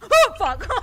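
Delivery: high loud laughing swear; bursting out laughing. Real, not acted. Streamer jump-scared by the video, laughing hard. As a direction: lose it in a fit of laughter. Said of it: "I'm crying".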